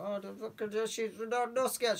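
high-pitched imitation of onlookers; mock-impressed reaction. Imitating people viewing her Instagram.

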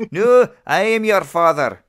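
high, loud Scottish Vader; Scottish accent. Retold or imitated. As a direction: belt it in a hammy Scottish accent. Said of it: "thick Scottish accent".

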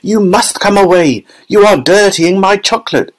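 high-pitched agitated protest; flustered, high-voiced pleading; Mister Wonka. A narrator's reading. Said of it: "cried Mister Wonka, hopping up and down".

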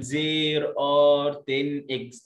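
slow, word by word; careful separated reading. Shown as a demonstration. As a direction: say each word apart for the pupils. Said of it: "لكن إحنا بنقول".

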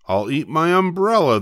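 ordinary delivery

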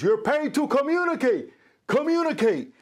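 high, emphatic mentor voice; quoting Larry King. Retold or imitated. Mentor demonstrating to a student, high emphatic delivery. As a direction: stretch out communicate with a high, falling pitch. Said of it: "And he goes like this".

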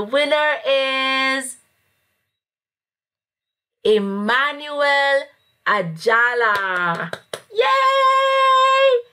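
high, drawn-out winner reveal; hyped giveaway announcement. Real, not acted. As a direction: build suspense, then cheer.

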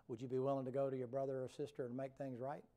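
usual speaking voice

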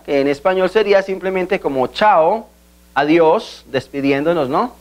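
ordinary talk

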